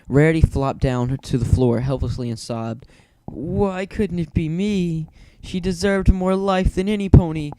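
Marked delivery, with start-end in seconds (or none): 3.3-7.5 higher, slow, grief-stricken lament of a lady; distraught mumble. A narrator's reading. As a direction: wail she should have been the one. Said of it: "Rarity mumbled".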